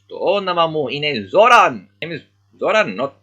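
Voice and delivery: put-on indignant boy correcting his name; annoyed insistence. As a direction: correct them sharply, fed up. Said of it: "Zoran is not happy with this".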